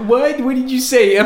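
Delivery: put-on a startled, fussy old man mishearing; Woody Allen baffled on set; Woody Allen impression. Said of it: "It's an impression".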